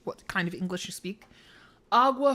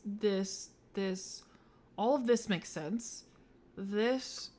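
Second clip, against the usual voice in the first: ordinary talk, nothing marked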